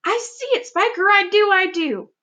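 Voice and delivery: very high, thrilled voices; excited aunts. Acted. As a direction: squeal as it speeds up. Aunts excited at speeding peach.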